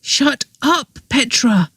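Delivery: hushed urgent warning; brother's urgent warning to his sister. A narrator's reading. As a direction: shut her up, urgently. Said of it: "said her brother urgently".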